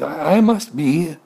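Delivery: slow high stammering confession; reluctant admission. Acted. Pig reluctantly admits he's a pig. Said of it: "didn't want to admit it".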